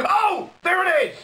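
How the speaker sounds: high, loud, hammed-up gasp of discovery; mock surprised shout. Mocking the show's twist, he shouts in fake surprise. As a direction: shout it like you finally found the twist.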